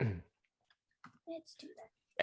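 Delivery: breathy slow whisper. Real, not acted. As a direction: whisper it like a secret. Whispered decision at table.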